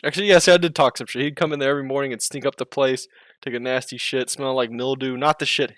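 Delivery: usual speaking voice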